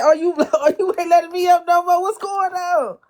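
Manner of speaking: high laughing quote; playful high-pitched laughing complaint; laughing retelling. Retold or imitated. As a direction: laugh through the protest. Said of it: "I said".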